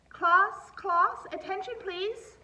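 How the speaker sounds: very high, loud call for attention; calling the class. Acted. Principal quieting a classroom, high loud call. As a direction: rise over the noise.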